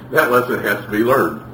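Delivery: usual speaking voice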